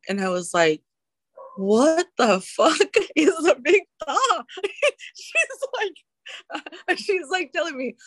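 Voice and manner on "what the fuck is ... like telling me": high-pitched, incredulous laughing retelling. Real, not acted. Recovering drinker recalls her reply to her sober coach, laughing. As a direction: crack up mid-sentence, voice shooting up.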